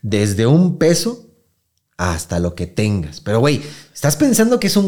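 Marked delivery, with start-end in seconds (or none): 0.0-3.2 slow menacing quote. Retold or imitated. Retold extortion, slow menacing quote.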